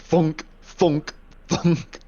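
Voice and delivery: high, hollow "thunk" sound effects; imitated thunk. Player mimicking crowbars bonking his head, mock thunks.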